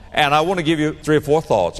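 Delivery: ordinary talk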